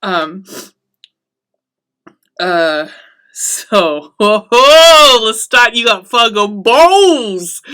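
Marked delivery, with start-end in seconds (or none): 4.2-7.6 louder, slow, delighted exclaim; laughing cheer. Real, not acted. She hoots to her viewers at Lestat's audacity, loud and laughing. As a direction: hoot at his nerve.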